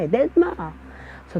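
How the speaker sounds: usual speaking voice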